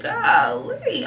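high, loud exasperated wail; exasperated cry. Retold or imitated. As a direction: throw up your hands with a loud cry. Exasperated outburst at rude guard.

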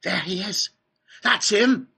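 high, rising shout of recognition; excited onlooker cry. A narrator's reading. Onlooker spotting the man at the gates, excited cry. Crowd spots Wonka at gates.

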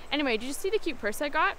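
normal talking voice

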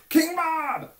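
put-on very high, loud child's cry of King Bob; child at children's church yelling King Bob; kid's King Bob shout. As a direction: shriek it like an excited little kid. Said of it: "the one kid that likes to do the".

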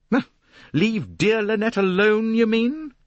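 high, loud scornful question; mocking. A narrator's reading. Jacqueline answering Poirot's plea about Lynette, loud and mocking. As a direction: fling it back shrewdly, pitched high.

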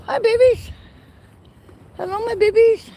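high sing-out to the babies; high cooing hello; pet-talk greeting. Real, not acted. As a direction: greet tiny animals in a high, loving voice. Greeting baby goats in pet voice.

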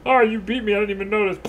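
high mock-surprised victim voice; beaten foe. Voicing a slain enemy who didn't notice, high mock surprise. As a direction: pipe up as a clueless defeated enemy. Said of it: "and they're all like".

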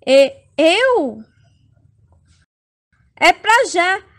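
ordinary delivery

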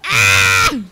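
loud, high scream; sudden scream. Real, not acted. Player startled mid-race, screaming. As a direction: shriek in alarm.

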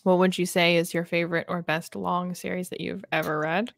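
usual speaking voice

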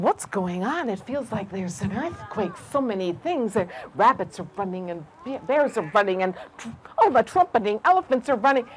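low, soft snake voice. A narrator's reading. Snake feeling the ground quake, low soft voice. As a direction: keep it hushed and low.

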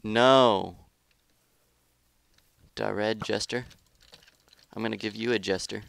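ordinary talk, nothing marked

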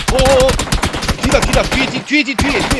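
high, loud frantic yelling; panicked warning shout. Real, not acted. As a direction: scream the warning behind you. Enemy behind, panicked warning.

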